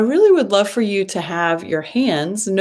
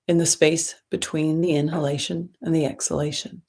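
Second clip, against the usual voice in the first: guided meditation. Acted. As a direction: ease them into the breath, gently and unhurried.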